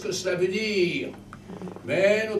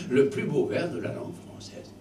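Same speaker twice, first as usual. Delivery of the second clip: ordinary talk, nothing marked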